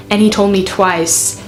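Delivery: normal talking voice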